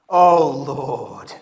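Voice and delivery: intoning like a new believer praying aloud; a hint of a fervent prayer; solemn prayer voice. [name] praying aloud to the circle, solemn intoning.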